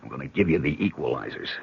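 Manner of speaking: quiet urgent order; hushed. Acted. Sneaking past armed zombie below.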